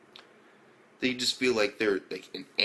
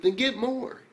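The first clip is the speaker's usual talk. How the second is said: mock demand; 13 semitones higher, 4 dB louder than usual. Character snaps back demand.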